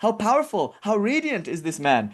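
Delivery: high, gushing admiration; admiring mother. Retold or imitated. Mother admiring the latest suitor, high and gushing. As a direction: exclaim over him in a high mother voice. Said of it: "she says".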